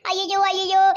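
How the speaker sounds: normal talking voice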